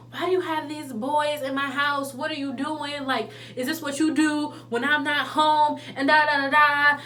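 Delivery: angry dad quote. Retold or imitated. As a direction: relay the dad's scolding lightly. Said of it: "My dad's cursing at me, talking about".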